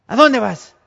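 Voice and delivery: a mother's sudden high, loud call; mother's shout. Retold or imitated. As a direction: catch the kid red-handed with a sharp yell. Mother catching the child.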